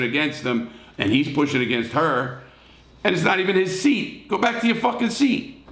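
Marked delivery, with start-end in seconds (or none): 3.0-5.7 outraged. Real, not acted. Host outraged at rude passenger.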